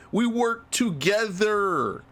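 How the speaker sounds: each word dragged out slowly; slow mock drawl. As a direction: stretch it out, dopey and slow. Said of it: "he was like".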